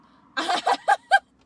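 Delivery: loud, high cackle; high burst of laughter. Real, not acted. Amused at being called his girlfriend, loud high laughing. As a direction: break into a big laugh.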